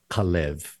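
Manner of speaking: Kalev with the accent on the second syllable; stress on second syllable. Shown as a demonstration. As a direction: lean on the last syllable of Kalev. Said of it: "the accent on the second syllable".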